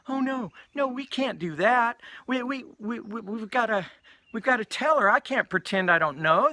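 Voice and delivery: high, stammering imitation of a woman; distressed objection. Storyteller voicing sweet Donna refusing to pretend, high distressed pleading.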